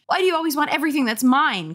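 high, fast sibling complaint; angry demand. A narrator's reading. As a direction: fire off an indignant question. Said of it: "Carly Beth demanded angrily".

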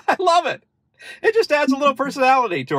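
very high, giggly delight; delighted laughing. Real, not acted. Loving the car's new chimes with viewers, delighted laughing. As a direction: squeal it out through real laughter, quickly.

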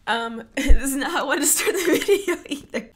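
loud embarrassed laugh breaking through crying; laughing through tears. Real, not acted. Vlogger embarrassed at crying to start the video, laughing. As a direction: laugh it off while still teary.

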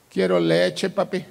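mild mock child's whine; crying child. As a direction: plead like a little son to his dad. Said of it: "mi hijo empezó a llorar".

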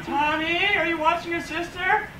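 high, calling mom from another room; high calling mother voice. Retold or imitated.

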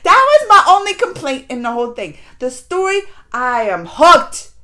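can't contain how hooked she is; excited rave. Real, not acted. Viewer raving to the camera about the show, loud and excited.

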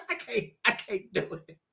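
quiet laugh running through the words; giggling refusal, breath full of laughter; laughing softly. Real, not acted. Preacher to the brothers, soft laughing refusal.

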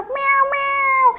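high kitten meow with a laugh in it. Pretend. Voicing the Valentine kitty, high meow. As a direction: mew like a playful kitten.

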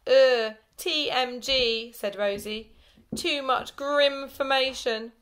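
loud, slow, drawn-out disgust. A narrator's reading. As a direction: groan it out in disgust. Disgusted schoolgirl.

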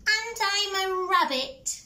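high bouncy rabbit voice; little rabbit. Acted. Rabbit answers the troll under the bridge, high squeak.

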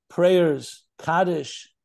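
ordinary talk

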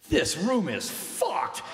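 slow, high emphatic shout; blunt outburst. Retold or imitated. Visitor telling the sick boy the truth, blunt shout. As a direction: declare it loudly, stretching the words for a laugh.

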